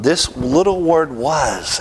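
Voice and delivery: "was" stretched out after a high-pitched lead-in; single out "was" as the key word; emphatic stress. Real, not acted.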